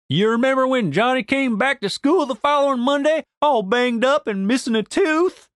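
high-pitched Southern storyteller; leading, high character question; knowing Denise. Acted. Narrator voices Denise hinting.

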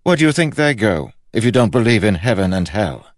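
high, loud, agitated questioning; genuinely disturbed. A narrator's reading. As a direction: demand an answer as a troubled king. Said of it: "seemingly genuinely disturbed".

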